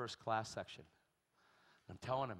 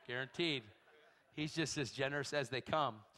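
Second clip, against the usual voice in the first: ordinary talk, nothing marked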